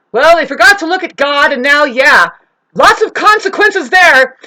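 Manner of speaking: high, loud mock proclamation; loud preachy saying. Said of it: "It's the same as saying".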